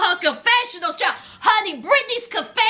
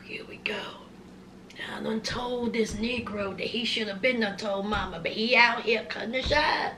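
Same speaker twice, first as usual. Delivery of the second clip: low, soft whispered aside; hushed exasperated brother; 7 semitones lower, 10 dB quieter than usual. Retold or imitated. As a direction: grumble it under your breath. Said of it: "His brother was like".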